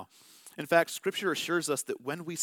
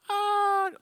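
much higher, loud mock kid's wail; child's distant scream; 6 dB louder than usual. Parent recognizing his child's cry, mock high scream. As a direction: imitate your own kid screaming off in the distance.

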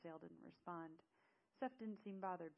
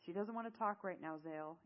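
usual speaking voice

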